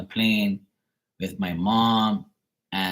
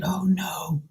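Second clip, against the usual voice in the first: retold fright; 9 semitones higher than usual. Retelling a plane crash seen in a dream, mildly acted fright. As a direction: replay a scared reaction up high, lightly acted. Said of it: "scared in my, in the dream".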